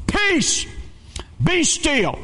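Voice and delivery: high, ringing command to a storm; calling out a command. Acted. Jesus to the wind and waves on a rocking boat, high commanding call. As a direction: call it out high, drawing out each word.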